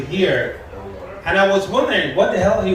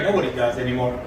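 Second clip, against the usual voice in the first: normal talking voice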